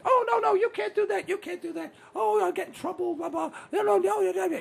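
high mock-child whine; very high, frantic put-on kid voice; granddaughter panicking. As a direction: imitate a little girl fretting in a squeal. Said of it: "she says".

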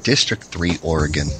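ordinary talk

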